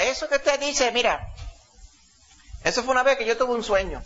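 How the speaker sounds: high imitated old man telling his dream; old man's voice. Preacher voicing the old man telling the youth group about his dream, high old man's voice. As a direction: lift the voice high and play the old storyteller. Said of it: "hablaba con esa voz".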